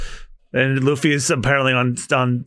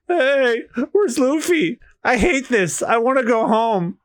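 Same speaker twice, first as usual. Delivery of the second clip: whiny scared crewmate; 8 semitones higher, slower than usual. Acted.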